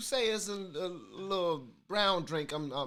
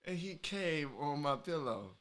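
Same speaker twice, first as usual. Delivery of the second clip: ordinary delivery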